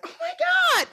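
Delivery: put-on girlfriend's shocked squeal after being thrown up on; shocked gasp. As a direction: squeal it way up high like she's horrified. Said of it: "She was like".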